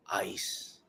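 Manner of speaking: breathy whispered hype word. Pretend. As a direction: whisper it like a secret. Whispered hype after line.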